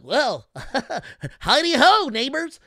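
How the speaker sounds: high, bright, loud, corny neighborly greeting. Retold or imitated. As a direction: do the hokey nice-neighbor voice. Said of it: "they would use the voice".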